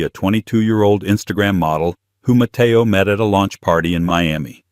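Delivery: usual speaking voice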